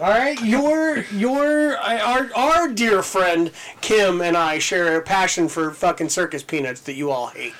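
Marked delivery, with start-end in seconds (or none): none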